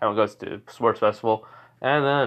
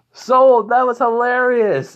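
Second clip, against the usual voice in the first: high, loud drawn-out holler; shouting dad; 13 semitones higher, 7 dB louder, slower than usual. Acted. Dad yells to son from stands, gleeful shout. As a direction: holler it slowly across a stadium.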